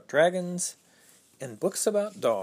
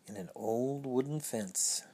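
whisper; 7 dB quieter than usual. A narrator's reading. Suspense at the gate.

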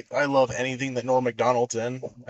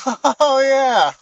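high, loud realizing Oh yeah; 12 semitones higher, 8 dB louder than usual. Retold or imitated. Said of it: "He goes".

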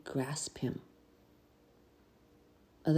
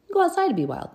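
call to go outside; 14 semitones higher, 5 dB louder than usual. Retold or imitated. Retelling the family rule.

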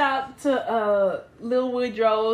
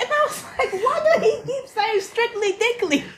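laughing; 9 semitones higher than usual. Real, not acted. Laughing disbelief.

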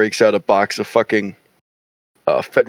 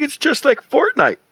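put-on much higher, a light kid voice; imitating a kid; 13 semitones higher than usual. Retelling his young stepbrother at the range, light kid voice. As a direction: pipe up like an unbothered eleven-year-old. Eleven-year-old stepbrother quoted.

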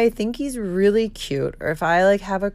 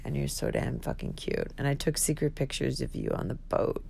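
low, hushed admission trailing softer; confiding to crush; 6 semitones lower, 9 dB quieter than usual. Real, not acted. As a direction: confess sweet secrets under your breath. Confides praise to her crush.

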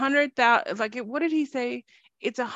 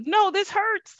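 much higher protesting whine; high pained whine; 12 semitones higher, slower than usual. Retold or imitated. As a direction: cry out that it hurts. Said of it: "Then I'll be like".

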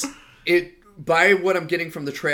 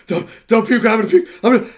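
panicked; 8 semitones higher, 11 dB louder, faster than usual. Retold or imitated. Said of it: "he's like".

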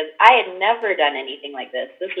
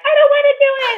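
much higher, whimpering reluctance; whiny refusal; much faster than usual. Retold or imitated. Imitating another retreat member balking at the drops, a high whiny refusal. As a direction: plead like a scared kid who won't go on.

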